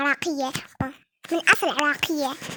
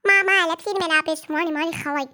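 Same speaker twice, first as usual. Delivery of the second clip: normal talking voice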